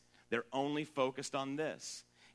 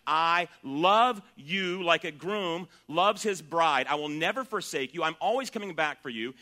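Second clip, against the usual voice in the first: tender promise; 4 semitones higher, 8 dB louder than usual. Acted. Voicing God's promise to the church.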